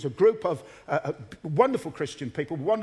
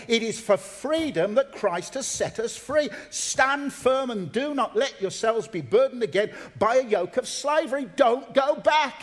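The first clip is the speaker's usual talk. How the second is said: raised, emphatic reading that builds to a loud plea; full-force scripture preaching; 6 semitones higher, 4 dB louder than usual. Real, not acted. Preacher reading Paul's argument for freedom, forceful and urgent. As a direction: drive the verse home, rising in force.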